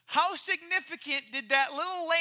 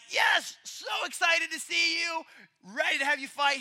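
mock excitement; 5 semitones higher, 5 dB louder than usual. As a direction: shout the welcome with fake glee. King mocks eager welcome.

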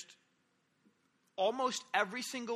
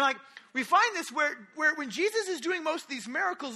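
ordinary talk, nothing marked

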